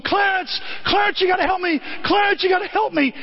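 high, frantic begging; desperate pleading George. Retold or imitated. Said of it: "he's going".

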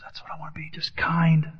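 a hushed plea, barely voiced; whispered prayer. Real, not acted. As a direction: whisper it to yourself.

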